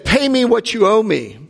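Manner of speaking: high, sharp demand for payment; demanding master. Acted. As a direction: act the creditor demanding his money. Master demands debt repaid.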